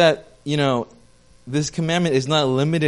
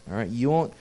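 ordinary delivery

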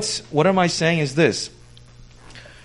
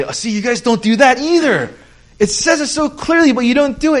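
much higher and louder, gotcha tone; mock accuser; 10 semitones higher, 5 dB louder than usual. Pastor mimics a critic accusing Christians of hypocrisy. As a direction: needle them like a smug critic. Objector to the church mocked.

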